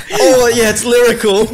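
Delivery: loud, very high fan cheer; hammed-up elation, voice shooting up; mock overjoyed. Fan hearing a new [name] track, mock overjoyed.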